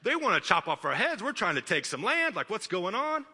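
high-pitched comic objection; mock incredulous protest. Preacher voices his reaction to the prophet wanting music, mock incredulous. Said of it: "I'm like".

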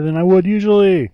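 "usually" swooping up high and loud; exaggerated inflection. Real, not acted. Host worried about his mic, exaggerated inflection rising on "usually". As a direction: climb loudly in pitch through "would usually". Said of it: "A lot of afflection".

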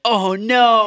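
hammed-up Skeletor dismay; mock dismay. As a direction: cry it like a beaten villain.

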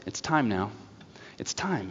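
soft, hushed self-talk; quiet resolve. Retold or imitated. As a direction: murmur it to yourself, steeling up. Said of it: "looks at the clock and goes".

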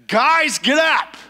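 shouted high and loud; dad's loud wake-up shout. Retold or imitated. Dad bursting in to wake the boys, shouting. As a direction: bark it like a dad at dawn. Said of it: "it was loud".

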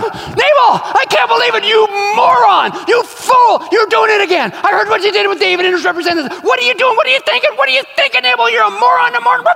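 high furious shouting tirade; shouting at husband. Retold or imitated. Wife screaming at her foolish husband Nabal, high angry shouting. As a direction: berate him at the top of her voice. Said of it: "and shouted at him".

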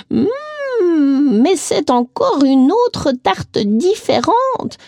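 aigu et très lent, étonnée; traîne sur les mots, intriguée; exclamation surprise. A narrator's reading. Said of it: "s'exclama-t-elle à nouveau". (la vieille dame découvre une autre tarte, aiguë et lente)

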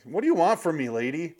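high, exasperated mock plea; hammed-up fed-up husband. Joining the mock husband bit.

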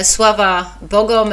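ordinary talk

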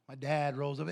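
normal talking voice

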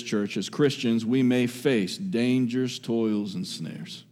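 ordinary delivery

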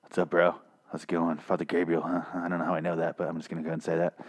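a quiet, casual killer; Beta voice. Retold or imitated. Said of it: "the Beta walks up to him and he's like".

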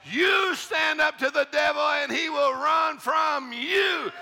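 high, loud, slow shouted preaching; full-force preaching. Acted. Preacher shouting down fear to the church, full force. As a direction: shout it out over the congregation.

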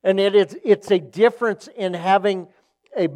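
loud, emphatic sermon delivery; forceful preaching. Real, not acted. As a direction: drive the point home, leaning hard on key words. Preaches the point at full force.

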